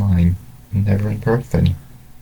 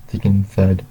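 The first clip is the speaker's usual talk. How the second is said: plain reading voice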